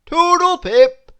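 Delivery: loud, high, sing-out farewell; cheery. Acted. Blackbird flying off from the mice, cheerily calling. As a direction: call out a jaunty goodbye. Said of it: "said Charles cheerily".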